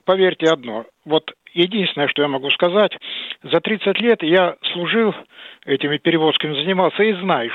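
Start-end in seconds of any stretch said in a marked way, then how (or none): none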